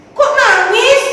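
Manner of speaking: high, loud, wide-eyed question; mock surprise. Teacher asking children why the king cries, surprised. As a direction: ham up the amazement for the kids.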